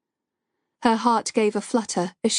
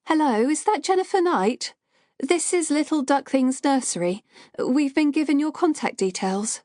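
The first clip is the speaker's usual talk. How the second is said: put-on higher, nervous young woman's voice; shaky young woman; 6 semitones higher than usual. A narrator's reading. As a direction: sound like an anxious nursery worker. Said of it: "A shaky young woman's voice".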